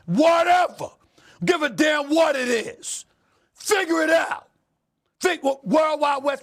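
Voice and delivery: loud, high, furious-sounding rant; shouted, impatient demand; exasperated shout. Real, not acted. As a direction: erupt at full volume, dismissing every excuse. Shouted rant climax demanding player figure it out.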